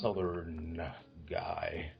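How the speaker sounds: very low, soft Southern drawl; mock Southern guy, dropped right down in pitch; Southern accent. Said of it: "he'll play it as a Southern guy".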